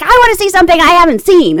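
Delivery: fast, high, loud mimicked demand; demanding old lady. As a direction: insist like a fussy elderly passenger.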